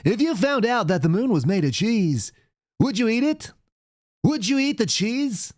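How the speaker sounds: high, slow, hammed-up broadcaster; silly old-time announcer musing on cheese; goofy announcer voice. Host mocking the news with a high cartoon announcer voice.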